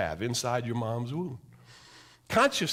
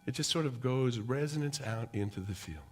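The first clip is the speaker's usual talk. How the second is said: normal talking voice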